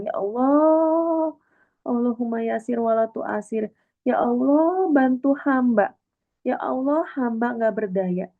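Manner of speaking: high, slow, plaintive begging; tearful pleading prayer. Pretend. Speaker voicing a weeping believer praying for help, slow and pleading. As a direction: beg God slowly, near tears. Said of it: "kita nangis dan kita bilang".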